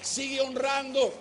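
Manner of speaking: impassioned preaching; fervent preaching. Acted. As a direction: drive it home from the pulpit. Preaching climax.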